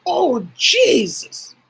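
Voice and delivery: loud, high, drawn-out mock astonishment; imitated shocked outburst, stretched slowly; startled exclamation. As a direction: cry out as if startled by something strange. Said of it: "Something strange happened. They go".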